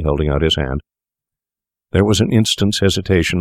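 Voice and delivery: usual speaking voice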